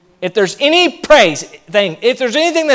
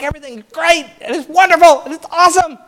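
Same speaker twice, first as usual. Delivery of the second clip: higher, bubbly mock enthusiasm; gushing optimist; 7 semitones higher than usual. Pastor mimicking an upbeat churchgoer, higher and gushing. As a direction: gush like everything's perfect. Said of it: "it's like".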